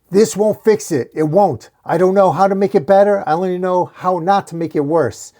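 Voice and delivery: loud, high desperate appeal; urgent pleading. A narrator's reading. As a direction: plead with someone you are holding back. Priest pleading while holding Batman back.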